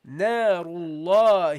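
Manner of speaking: Narullahil slow, joined to lam; teacher demonstrating the connection; slow recitation. Shown as a demonstration. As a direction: link Narullahil smoothly into the lam. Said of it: "So you say".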